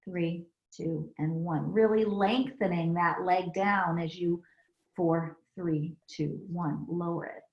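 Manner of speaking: soft counted yoga cues, easing down; calm instructor counting breaths; yoga breath cueing. Acted. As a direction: count them through the breath, gently.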